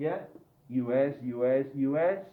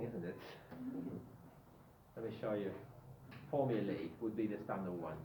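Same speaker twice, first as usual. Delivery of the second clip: ordinary delivery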